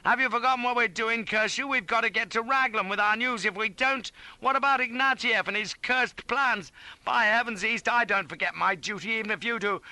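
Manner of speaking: high, loud, righteous tirade; indignant harangue. A narrator's reading. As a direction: harangue him with patriotic outrage. Shouted patriotic harangue at East.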